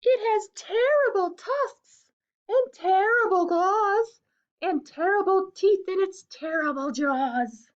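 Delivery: high, loud, slow mouse spinning a scary tale; mouse spooking fox. Acted. Mouse scares the fox, high and dramatic. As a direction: make the monster sound dreadful.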